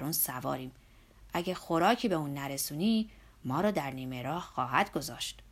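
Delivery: put-on a hearty old worker nagging his boss; Zorba's voice.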